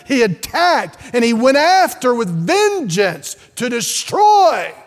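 loud, high climactic preaching; preaching at full force. Real, not acted. Preacher warning the church about the serpent, at full shout. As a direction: build it up to a thundering peak.

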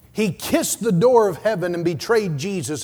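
ordinary talk

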